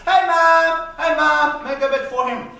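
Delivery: loud, high call to the next room; father calling his wife, loud and high. Acted. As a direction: shout for Mom to make a bed.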